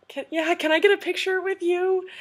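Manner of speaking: high, loud, excited ask; retold starstruck eagerness; eager fan request. Eager fan asking for photo.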